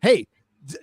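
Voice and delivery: ordinary delivery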